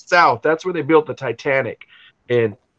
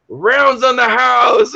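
high, slow, drawn-out announcement; celebratory call-out; 10 semitones higher, 3 dB louder, much slower than usual. Retold or imitated. Bartender to the whole pub after everybody flipped out, celebratory call-out. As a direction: imitate calling out to the whole room, stretching the words.

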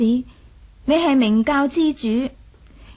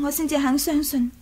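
normal talking voice

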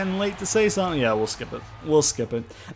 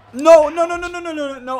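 panicked no; 13 semitones higher, 9 dB louder than usual. Real, not acted. Panicked over a failed reversal.

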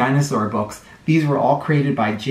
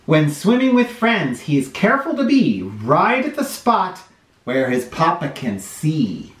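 put-on lively storybook read-aloud for little kids; sing-song picture book reading; reading to children; 2 semitones higher, slower than usual. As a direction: read it like story time on the rug.

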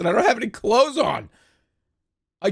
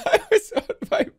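high, cracking up; laughing. Real, not acted. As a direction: dissolve into laughter. Breaks into laughter.

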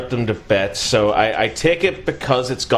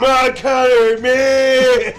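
high, loud, drawn-out yell; mock battle cry; 12 semitones higher, 5 dB louder, much slower than usual. Host parodying Mad Catter's shouting, hammed-up high yell. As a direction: holler it like a hammy wrestler.

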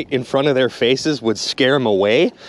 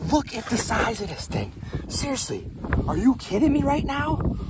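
higher, hushed amazement; softer, higher astonished voice; awed excitement; 7 semitones higher, 7 dB quieter than usual. Real, not acted. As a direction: marvel at the fish in a soft raised voice. Amazed at huge perch.

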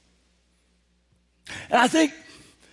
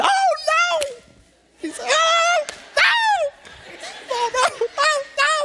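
nephew's wail. Retold or imitated. Uncle voicing his nephew's wailing "oh no". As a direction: wail it like a ten-year-old dreading homework. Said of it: "He started crying".

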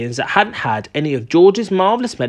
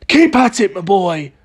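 crying out; 3 semitones higher than usual. A narrator's reading. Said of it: "cried Mister Cranky".

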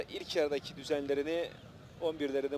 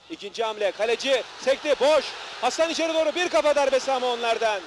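excited commentator; 9 semitones higher, 8 dB louder than usual. Real, not acted. Near miss chance in goal area.

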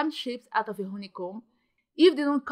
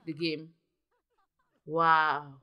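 ordinary delivery

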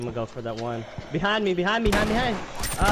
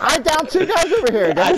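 shouted callout; 10 semitones higher, 7 dB louder than usual. Real, not acted. Excited kill callout.

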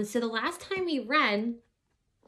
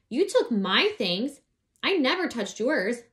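angry insisting; 4 semitones higher, 6 dB louder than usual. Acted. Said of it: "I never heard her sound that angry".

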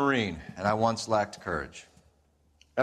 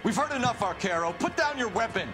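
high, projected call; firm shouted warning; police command; 11 semitones higher than usual. Acted. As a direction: call out an order to a gunman. Policeman orders gunman to disarm.

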